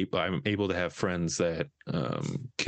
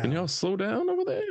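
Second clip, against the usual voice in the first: mock call out; 14 semitones higher than usual. Joking at growing kids.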